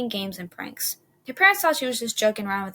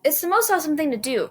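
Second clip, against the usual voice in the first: higher and louder, thrilled; enthusiastic; 7 semitones higher, 5 dB louder than usual. Acted. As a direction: sell the fun. Jill enthusing.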